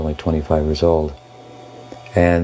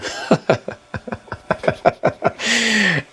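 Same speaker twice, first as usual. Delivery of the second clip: long high-pitched laugh; real drawn-out laughter ending high. Real, not acted. Monk amused by the guru's question, long high laugh. Laughs at his own predicament.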